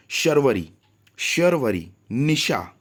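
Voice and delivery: teacher drilling the sh in शर्वरी; clear sh sound. Shown as a demonstration. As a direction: stress the श in शर्वरी and निशा. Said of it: "जैसे अगर श है तो श ही करिए".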